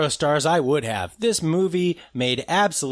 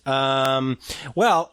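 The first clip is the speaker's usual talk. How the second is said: ordinary talk, nothing marked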